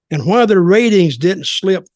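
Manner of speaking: normal talking voice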